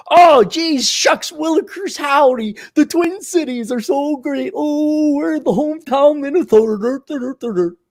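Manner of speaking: high-pitched mock hometown booster; folksy old-timer. Old-timer praising the Twin Cities, high folksy mockery. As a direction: gush naively in a high, corny voice. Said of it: "these old timers and these old farts".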